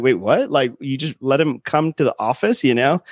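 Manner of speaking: normal talking voice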